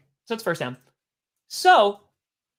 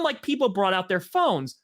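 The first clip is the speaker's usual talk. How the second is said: ordinary talk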